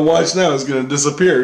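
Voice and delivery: P=A; confident announcement. Retold or imitated. Predicting UFO will vanish.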